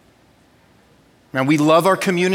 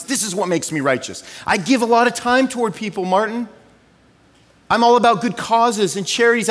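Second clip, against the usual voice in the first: higher, eager self-praising voice; self-righteous do-gooder; 7 semitones higher, 4 dB louder, faster than usual. Retold or imitated. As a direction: puff up, proud of good deeds. Imagined self-righteous person quoted.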